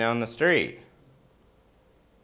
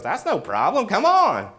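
higher, building louder on "Come on"; welcoming rabbi; 9 semitones higher, 4 dB louder than usual. Retold or imitated. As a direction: wave them in cheerfully. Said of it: "And he goes".

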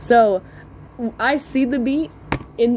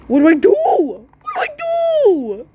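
squeaky high panicked cry; mock panic; 13 semitones higher, 5 dB louder than usual. Boy panicking as the older kid charges at him, with a squeal. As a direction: yelp like a cornered kid.

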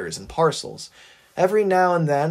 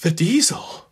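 shaky wheeze; 3 semitones higher than usual. A narrator's reading. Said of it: "gulped and wheezed a shaky response".

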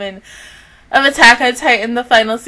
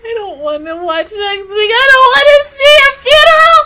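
real tears, voice breaking high and loud into a wail; crying; 12 semitones higher, 6 dB louder than usual. Real, not acted. Fan sobbing over a dead character, pitch breaking into a wail. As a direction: sob it out, pitch cracking upward. Viewer mourning a character's death.

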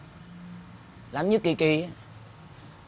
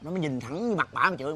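ordinary talk

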